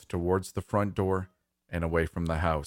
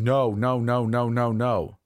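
higher, louder yelled string of no's; panicked yelling; 5 semitones higher, 4 dB louder than usual. Acted. Dad reacting as mom screams, yelling. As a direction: yell out in protest, pitch raised. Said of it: "my dad yelling".

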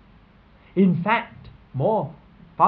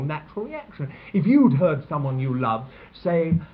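ordinary talk, nothing marked

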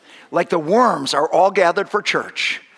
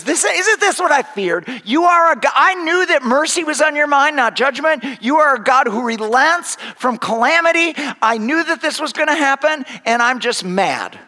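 higher, louder, indignant complaining; angry Jonah; 8 semitones higher, 3 dB louder than usual. Acted. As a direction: rant at God like a sulking prophet. Jonah pouting angry at God.

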